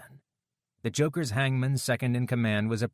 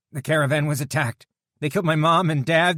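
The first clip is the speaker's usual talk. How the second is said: grieving cry; 7 semitones higher, 8 dB louder than usual. A narrator's reading. Grieving survivor tells of murder.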